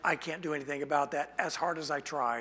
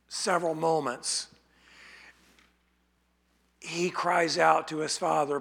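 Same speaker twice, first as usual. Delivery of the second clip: normal talking voice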